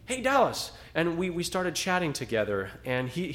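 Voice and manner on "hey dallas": high, bright call across the street. Retold or imitated. Acquaintance near campus hails Dallas, a raised friendly call. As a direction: hail a friend from a distance. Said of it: "he says".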